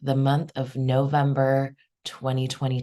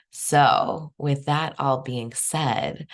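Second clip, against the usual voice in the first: ordinary talk, nothing marked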